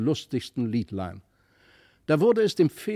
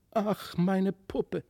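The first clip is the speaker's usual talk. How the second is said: distressed cry; 9 semitones higher than usual. A narrator's reading. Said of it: "schrie aber Christlieb laut auf".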